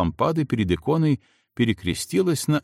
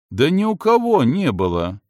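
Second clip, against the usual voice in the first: pleading, louder and higher; 6 semitones higher, 4 dB louder than usual. A narrator's reading. Федька begs the insistent girl to stop, pleading louder. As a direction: beg her to drop it, voice rising. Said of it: "взмолился Федька".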